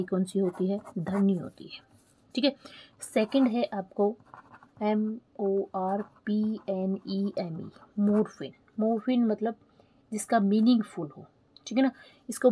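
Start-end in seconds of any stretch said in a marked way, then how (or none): 4.8-7.7 slow letter-by-letter dictation; spelling out. Shown as a demonstration. Teacher dictating a term to students, spelled out slowly.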